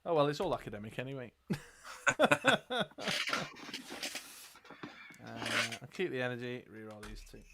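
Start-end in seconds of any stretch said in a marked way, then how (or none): 1.4-5.2 long, high, rattling laugh; helpless high-pitched laughter; cackling laugh. Real, not acted. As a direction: burst into a drawn-out high cackle. Laughs at own academic remark.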